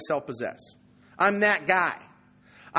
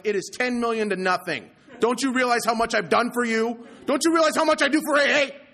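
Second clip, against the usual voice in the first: rising from plain to a louder, higher indignant yell; building retold rant; 7 semitones higher, 5 dB louder than usual. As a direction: escalate into outraged bragging. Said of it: "I freak out and I scream and I yell".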